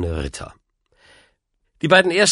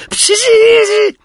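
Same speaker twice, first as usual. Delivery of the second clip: high, loud screech; piercing scornful outburst; derisive shriek; 9 dB louder than usual. A narrator's reading. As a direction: screech it with utter contempt. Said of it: "schrie der alte Mann".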